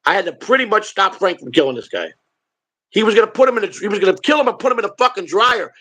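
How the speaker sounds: ordinary delivery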